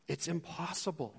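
quiet, resigned "impossible"; muted resignation; soft. Shown as a demonstration. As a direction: let it fall softly. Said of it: "repeat softly".

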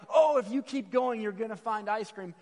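high, eager encouragement, loud at the start; mock-excited, sharing great news; excited kid. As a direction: cheer on another kid in the hunt. Acts kids eager to share.

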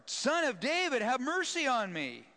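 high, loud cry, falling on mercy; desperate shout. Acted. Blind man shouting over the crowd, loud plea. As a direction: shout it again even harder. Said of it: "he shouted all the more".

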